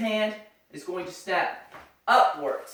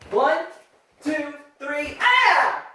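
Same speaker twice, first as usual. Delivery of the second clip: sharp martial arts commands; 7 semitones higher, 4 dB louder, much slower than usual. Shown as a demonstration. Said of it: "execute three high punches".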